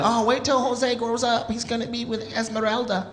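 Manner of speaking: imitated parent.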